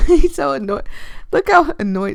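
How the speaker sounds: loud, high laughing delight; laughing loudly. Real, not acted. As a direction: burst out laughing as you say it. Laughing at annoyed character.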